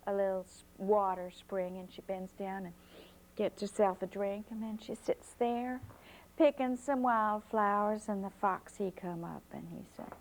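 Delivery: usual speaking voice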